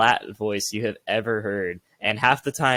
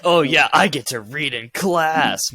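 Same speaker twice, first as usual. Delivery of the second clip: louder, higher, drawn-out eager student; hammed-up keenness, stretched out slowly; mock enthusiasm; 4 semitones higher, 4 dB louder than usual. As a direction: gush as if thrilled to be picked.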